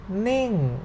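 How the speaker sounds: "Ning" peaking high, then dropping; falling tone. Shown as a demonstration. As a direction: swing "Ning" up and slide it down. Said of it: "falling sound".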